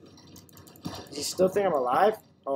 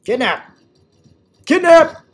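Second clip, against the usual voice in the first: loud, rising high cry of kidnap; gleeful shout; 13 semitones higher, 14 dB louder, slower than usual. Acted. Player grabs enemy and shouts in glee. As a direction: shout it in triumph. Grabbing hostage.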